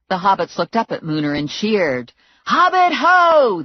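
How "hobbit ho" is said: much higher, slow, loud cry; battle cry. A narrator's reading. Hobbits charging into the house, loud battle cry. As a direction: bellow it as you charge. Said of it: "they yelled".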